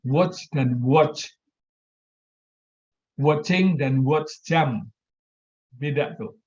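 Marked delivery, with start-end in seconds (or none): 0.0-1.3 "Watch dan watch" drawn out very slowly, both said /wotʃ/; English watch demo. Shown as a demonstration. Lecturer to students, slowly modelling English "watch". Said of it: "Hampir kedengaran sama". 3.2-4.9 "Watching" and "watch" stretched very slowly as /wotʃiŋ/ and /wotʃ/; English word demo. Shown as a demonstration. Lecturer to students, slow demo of English "watching". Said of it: "Hampir kedengaran sama".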